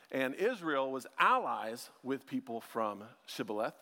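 usual speaking voice

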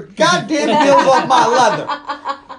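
loud, high, drawn-out bellow; mock angry yell. Car owner to girls with feet on seats, hammed-up outrage. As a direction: yell it in comic outrage, laughter in the voice.

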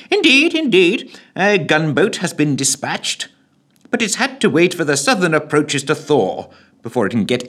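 high, eager official; reporting to the PM; secretary. Acted.

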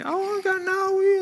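high, mock-wailing hurt kid; imitated crying child. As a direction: whine it like a child who fell down. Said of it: "and they're crying".

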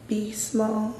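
soft, hushed voice in the head; a calm inner voice warning her. Retold or imitated. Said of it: "And then I heard a voice in my head".

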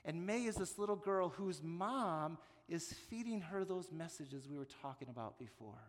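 normal talking voice

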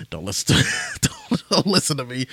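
real self-mocking laugh through the words. Real, not acted. Podcaster giving up on his own rambling, laughing. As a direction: break up laughing while brushing yourself off.

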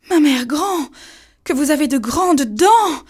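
high, loud startled question; a child suddenly frightened; alarmed girl. A narrator's reading. Girl notices the wolf's teeth, high and alarmed.

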